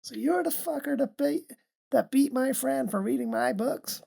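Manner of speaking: put-on Southern American accent. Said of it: "quite a good Southern American accent".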